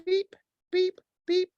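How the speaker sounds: high-pitched imitated scanner beeps; squeaky electronic beep, done by mouth; robot beeps. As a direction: beep like a malfunctioning machine. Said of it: "It's a malfunctioning robot".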